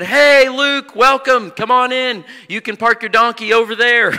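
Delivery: high, loud greeting called out; hearty welcome. Retold or imitated. Saint Peter welcoming new arrival at pearly gates, calling out. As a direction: usher them in with a big ringing hail.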